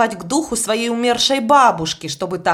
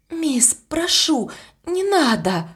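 slow, pleading a little higher; 7 semitones higher, slower than usual. A narrator's reading. Annie pleads with Miss to stop the scary talk, slow and pleading. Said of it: "попросила Энни".

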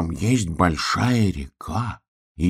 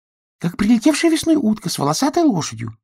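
little duck voice; 14 semitones higher than usual. Acted. Duck voice again.

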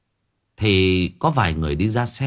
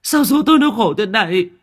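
much higher, louder howl of grief; wailing; 12 semitones higher, 5 dB louder than usual. Acted. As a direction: cry it out to the sky. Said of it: "đang vật vã gào khóc".

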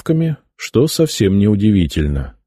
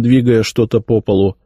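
ordinary delivery

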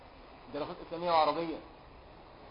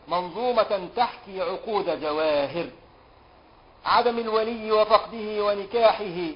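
slow, louder chanted verse; poem recitation; 2 semitones higher, 5 dB louder, slower than usual. Acted. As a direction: intone each line of the poem, stretching it out.

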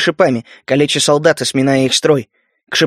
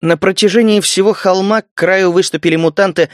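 ordinary delivery, nothing marked

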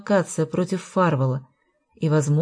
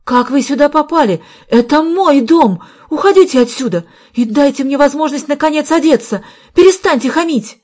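high, loud, furious protest; voice breaking into a shout; 9 semitones higher, 8 dB louder than usual. A narrator's reading. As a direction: lose control and cry out at the intruders. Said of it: "Её голос срывался на крик". (срываясь на крик)

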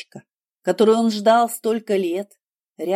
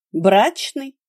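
amused reply; 3 semitones higher, 4 dB louder than usual. A narrator's reading. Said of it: "искорки смеха".

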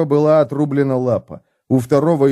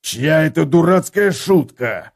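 growling; 4 semitones higher than usual. Acted. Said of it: "зарычал Готрек".

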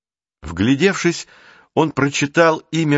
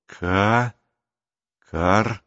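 halting, piece-by-piece reading; tentative partial word, breaking off; sounding out a word. A narrator's reading. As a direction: decipher it syllable by syllable, with pauses. Boy deciphers a crossed-out word.

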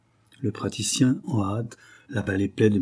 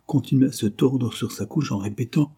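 usual speaking voice

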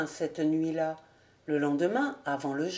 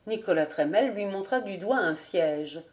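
plain reading voice

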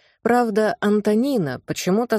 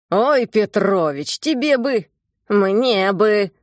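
grumbling; 3 semitones higher, 4 dB louder, slower than usual. A narrator's reading. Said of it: "проворчал дед".

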